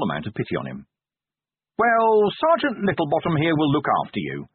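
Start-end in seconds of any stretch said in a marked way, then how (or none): 1.8-4.5 a hammed-up jolly opening, higher and louder; fake joviality. A narrator's reading. The Duke handing the inspector off, with fake joviality. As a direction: put on a hearty cheer you don't feel. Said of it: "he said with fake joviality".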